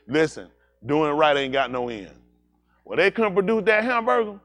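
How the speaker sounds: ordinary talk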